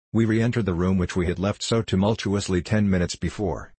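usual speaking voice

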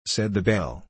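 plain reading voice

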